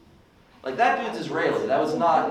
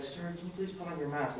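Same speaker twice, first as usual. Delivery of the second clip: much softer, timid polite ask; timid waitress; 12 dB quieter, faster than usual. Retold or imitated. Underpaid waitress meekly asking a customer to mask, very soft. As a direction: ask meekly, barely audible. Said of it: "waitress was like".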